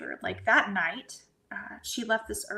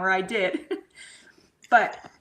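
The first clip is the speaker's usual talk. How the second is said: usual speaking voice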